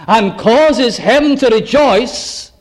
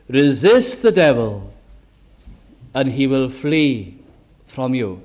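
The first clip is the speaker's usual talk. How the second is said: scripture reading; 7 semitones lower, slower than usual. Acted.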